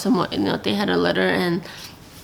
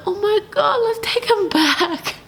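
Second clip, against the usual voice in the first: much higher, pleading mock distress; heartbroken squeal; 12 semitones higher than usual. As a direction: plead as if the puppy's cries hurt you. Retold reaction to crying puppy.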